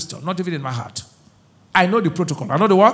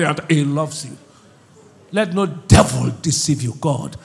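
normal talking voice

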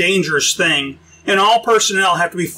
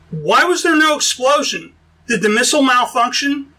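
confused, alarmed; 6 semitones higher than usual. A narrator's reading. Crew confused, missile vanished.

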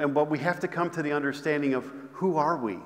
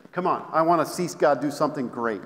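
usual speaking voice

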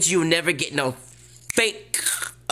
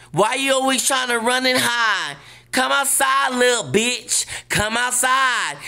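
high, louder taunting challenge; taunting call-out; 9 semitones higher, 4 dB louder than usual. Acted. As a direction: dare them to come outside. Calling out a rival to fight.

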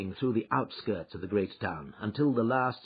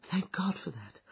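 relieved whisper; 8 semitones higher, 6 dB quieter than usual. Acted. Relief at no bad news.

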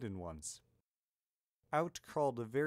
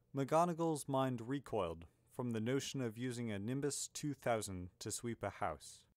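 ordinary delivery, nothing marked

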